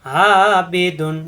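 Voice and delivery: আবিদুন very slow with the long vowel held, pressed from the throat; আবিদুন sung out slowly with one alif of length; one alif stretch. Shown as a demonstration. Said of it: "এক আলিফ টান, কণ্ঠনালীতে চাপ দিয়ে".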